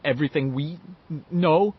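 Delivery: stammering, rising to a high question; anxious stutter, ending high; stuttering boy. A narrator's reading. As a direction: trip over the first sounds. Bill's stuttering voice in story.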